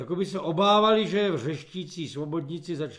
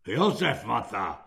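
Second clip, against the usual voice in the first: low, unruffled voice; calm reply; 7 semitones lower than usual. A narrator's reading. Kulak correcting himself to the corporal, calm and low. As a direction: answer calmly, pitched well down. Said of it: "řekl klidně kulak".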